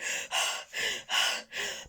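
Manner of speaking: long breathless exhale; panting breath. Acted. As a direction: still gasping from the run. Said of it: "panted Barney".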